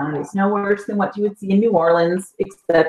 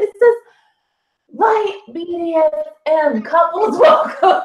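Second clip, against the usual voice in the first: laughing read-out; 9 semitones higher than usual. Retold or imitated. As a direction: break up laughing as you read it. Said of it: "And I said".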